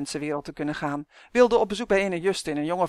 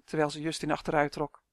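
ordinary delivery

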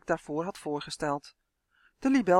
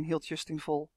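ordinary delivery

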